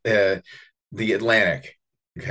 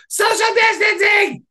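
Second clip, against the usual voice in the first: put-on child yelling; slower than usual. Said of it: "he yelled at her".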